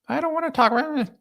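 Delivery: hammed-up wife brushing off husband; reluctant wife. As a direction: squeak out a sulky refusal as the wife. Role-plays the wife's reply to the talk.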